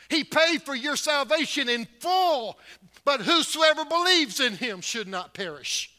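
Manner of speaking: loud, high, ringing pulpit voice; full-force preaching. Acted. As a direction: preach it hard to the congregation.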